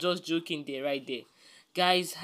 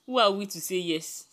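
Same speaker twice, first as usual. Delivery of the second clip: usual speaking voice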